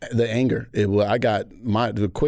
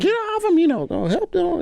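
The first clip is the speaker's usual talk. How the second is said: stranger lady shouting. Retold or imitated. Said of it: "She was like".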